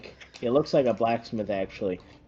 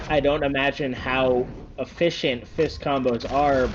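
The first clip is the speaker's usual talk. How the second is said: ordinary delivery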